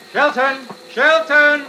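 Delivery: calling out. Acted. Actor calls for his producer as the air raid starts, shouting. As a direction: call out to someone who may be gone.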